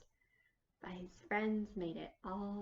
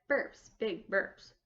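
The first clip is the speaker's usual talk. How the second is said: burpy voice; 8 dB louder, slower than usual. A narrator's reading. Hare burping.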